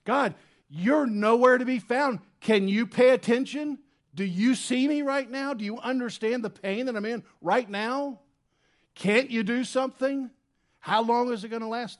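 high, loud anguished pleading; raw desperate prayer. Retold or imitated. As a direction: cry out to God, desperate and raw. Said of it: "no prim and proper prayer".

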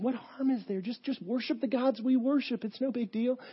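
high, coaxing official; coaxing sheriff. Retold or imitated. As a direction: wheedle him lightly. Sheriff coaxing Polycarp to worship.

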